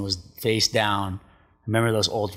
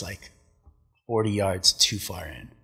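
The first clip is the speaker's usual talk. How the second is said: ordinary talk, nothing marked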